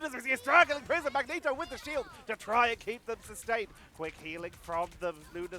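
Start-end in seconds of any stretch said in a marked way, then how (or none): 0.0-3.7 higher, louder excited caster; live esports play-by-play. Real, not acted. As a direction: jump urgently into the action.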